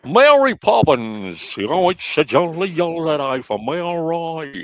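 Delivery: high, drawn-out mock Cockney; hammed-up bad Cockney accent, almost singing. Brit mocking a film Cockney for friends, high exaggerated Cockney.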